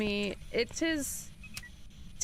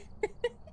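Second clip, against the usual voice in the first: breathy wheezing laugh; 11 semitones higher, 6 dB quieter than usual. Real, not acted. Laughing at the wig answer.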